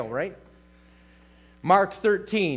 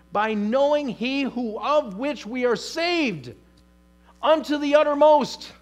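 impassioned preaching; 5 semitones higher than usual. Acted. Preacher pleading to God before his congregation, impassioned. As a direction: pour it out like a preacher at full force.

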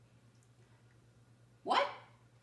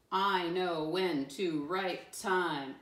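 very deliberate teacher reading; word by word for young learners; slow read-back; 3 dB louder, much slower than usual. Shown as a demonstration. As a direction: read each word slowly and separately. Reads back the written sentence.

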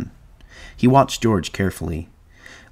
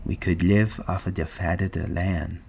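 soft, dreamy simple voice; hushed, savoring each word; softly; 7 dB quieter than usual. A narrator's reading. Lenny dreams aloud to George of the farm, softly. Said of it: "Lenny said softly".

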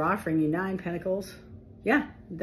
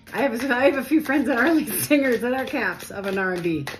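excited; 6 semitones higher, 7 dB louder than usual. Real, not acted. Excited laughing aside.

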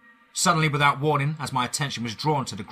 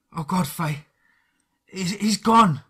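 high, loud breaking voice of grief; grief-stricken; 8 semitones higher, 7 dB louder, slower than usual. Acted. Dan telling Faye the boy is gone, breaking grief. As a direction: let the words crack on gone.

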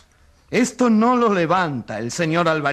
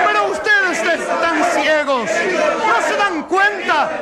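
high, raised appeal over the noise; desperate plea; 11 semitones higher than usual. Acted. Journalist pleading with the courtroom crowd, high and raised. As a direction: beg them to see it.

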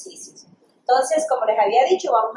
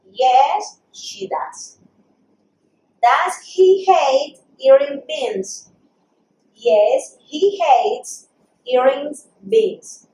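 very slow, deliberate English; pronunciation model; 3 semitones higher, 4 dB louder, much slower than usual. Shown as a demonstration. Teacher repeats exercise sentences for students, slow pronunciation drill. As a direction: draw each word out so learners can copy it. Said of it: "vamos a hacer la pronunciación una vez más".

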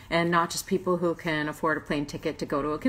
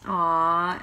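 usual speaking voice

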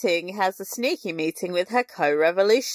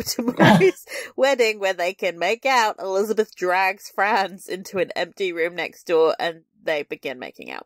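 normal talking voice

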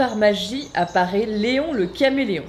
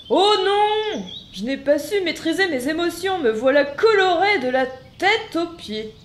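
dismayed cry; 9 semitones higher, 3 dB louder than usual. Acted. Chameleon dismayed at his colours.

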